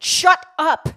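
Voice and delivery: very loud, high furious yell; explosive outburst. A narrator's reading. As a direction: explode at your sister. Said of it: "Rebecca exploded in the back seat".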